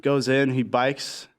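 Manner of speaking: ordinary talk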